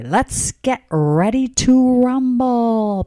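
ring announcer cry. Retold or imitated. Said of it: "he'll do the cry".

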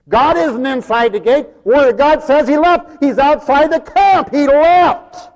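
high, loud pulpit proclamation; forceful preaching. Real, not acted. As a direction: hammer it out to the congregation.

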